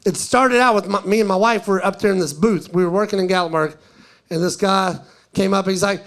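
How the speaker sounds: normal talking voice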